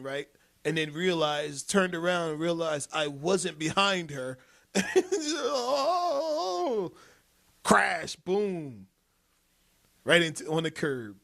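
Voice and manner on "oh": high startled little cry; a child's gasp of alarm, pitched up; shocked child cry. Retold or imitated. Dad imitating his daughter's startled cry as she notices he's gone.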